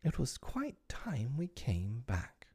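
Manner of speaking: slow, soft, high and whispery; remorseful. A narrator's reading. Wendy realising they stayed away, remorseful. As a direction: admit it softly and slowly, with regret. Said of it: "with her first real twinge of remorse".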